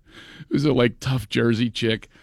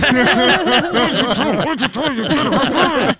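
much higher, slow, laughing through clenched teeth; ventriloquist, laughing; 11 semitones higher, 4 dB louder, slower than usual. Retold or imitated. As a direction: scold through a frozen grin while cracking up. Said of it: "trying to do the ventriloquist thing".

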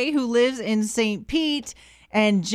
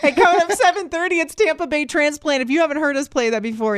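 radio promo hype; 4 semitones higher than usual. Acted. Radio host hyping the segment to morning listeners, upbeat announcer read. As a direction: sell the segment like a DJ.

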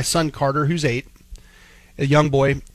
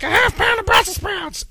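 much higher, nasal-free mock kid voice at a jeering pitch; mocking imitation. Dad imitating the clerk mocking his son, high jeering voice. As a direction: parody the boy cruelly, way up high. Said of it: "mocks my child".

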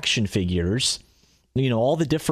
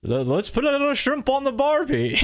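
a hammed-up, higher Australian accent; a clumsy stab at an Australian accent; bad Australian accent; 10 semitones higher than usual. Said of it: "Let's, let's hear it. Do the dad voice".

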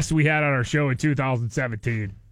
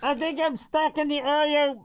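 high, muffled-sounding office lady; office lady stuck in box; 7 semitones higher, 3 dB quieter than usual. Pretend. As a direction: play a trapped, flustered office worker. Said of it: "office ladies walking around the office".

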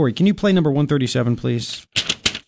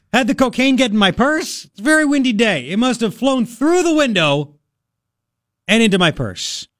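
mock suspect's excuse; 7 semitones higher than usual. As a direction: play dumb with a far-fetched excuse. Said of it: "Can you imagine being the police?".

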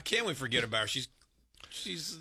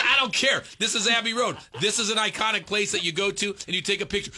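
insistent; 4 semitones higher, 4 dB louder than usual. Retold or imitated. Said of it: "I said".

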